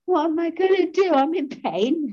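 high-pitched mock wail of self-pity; imitating your old high, pained voice; pitching old voice. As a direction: pitch it right up like someone in pain. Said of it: "in this voice, I've still got all my old voice".

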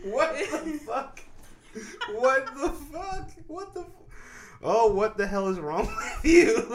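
high-pitched, drawn-out words through laughs; incredulous laughter. Real, not acted. Host disbelieving friend's drunk story, cracking up. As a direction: barely get it out for laughing.

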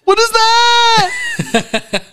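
high, very slow, drawn-out shout; hammed-up high yell of disgust; shrieked disbelief. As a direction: stretch it out in high shocked alarm. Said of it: "I was like".

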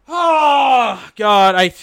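loud, high exasperated oh God; annoyed outburst. Real, not acted. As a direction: blurt it out in real frustration. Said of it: "I'm a little bit annoyed".

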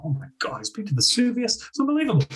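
high, squealing mock awe from the kids; mock amazement. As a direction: play the starstruck pupils, pitch jumping way up. Mock amazed students at Vesuvius photo.

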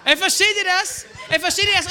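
loud, high, laughing; thrilled preacher asking; excited appeal. Real, not acted. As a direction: fire it at the crowd.

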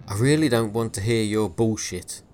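loud, curt scolding; angry bark. Acted. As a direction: bark it at the boy. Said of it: "Williams barked".

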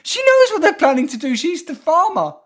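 very high, squeaky chicken protesting. Pretend. Co-host voicing a chicken mocking the farmer, squeaky high. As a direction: pitch way up like an indignant hen.

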